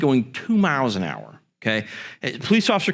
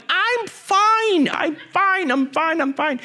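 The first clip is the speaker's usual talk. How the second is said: frantic reassurance; slower than usual. Retold or imitated. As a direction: insist nervously until it fades. Kid reassuring nurse frantically.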